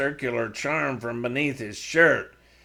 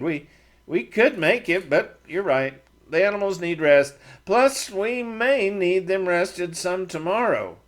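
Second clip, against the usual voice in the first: lightly higher voice for Matt, insisting; a hint of a defensive younger man; 6 semitones higher, 3 dB louder than usual. A narrator's reading. Matt giving in, argued.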